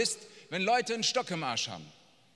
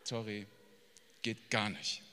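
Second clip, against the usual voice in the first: low, quiet, offhand brush-off; mock dismissal; 8 semitones lower, 11 dB quieter than usual. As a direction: pretend politely not to have heard. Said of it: "Jesus sagt nicht". (leise, abweisend gespielt)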